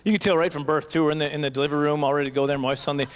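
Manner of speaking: ordinary talk, nothing marked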